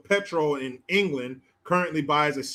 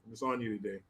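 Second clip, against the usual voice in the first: normal talking voice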